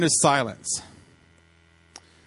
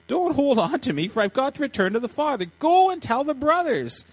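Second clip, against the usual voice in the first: lightly acted joy, pitched much higher; laughing delivery; 9 semitones higher than usual. Jesus to Mary clinging to him, joyfully amused. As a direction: say it high, as if amused. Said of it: "see him saying that laughing".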